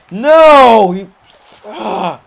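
a high, loud protest; alarmed yell. Real, not acted. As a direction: shout no as you get pushed. Gets pushed in game.

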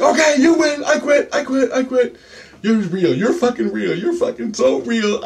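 high-pitched, repeated giving-in; frantic surrender. Retold or imitated. Giving in to the alien on waking, frantic surrender. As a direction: plead defeat in a strained high voice.

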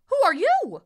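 high, loud bird's question; astonished cry. A narrator's reading. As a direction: ask in loud amazement. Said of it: "she cried".

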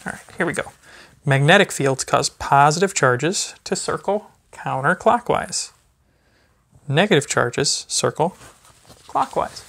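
sing-song picture-book reading to a little one; gentle, lively storybook voice for a toddler. Acted. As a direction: read it like bedtime story time for a baby. Reads a baby book aloud.

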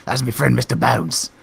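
put-on defending Mister Bones in a cheeky British accent; hammed-up British accent. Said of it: "through your change of accent".

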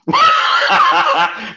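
very high burst of laughter; high squealing cackle; shrieking laugh. Real, not acted. Laughs at Belfast accent threat.

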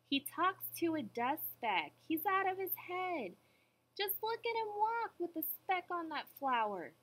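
high, incredulous tattling; gossiping mockery. A narrator's reading. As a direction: spread it as scandalous gossip. Jungle gossips mocking Horton.

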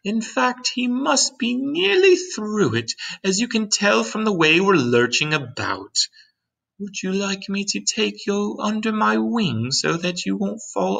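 high-pitched, gentle lady's voice; kind ladybug voice. A narrator's reading. Ladybug standing beside James to explain, kind high voice.

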